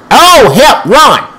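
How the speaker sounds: high, loud cry for help; alarmed cry. Real, not acted. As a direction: call out in sudden panic. Fleeing opponent in game.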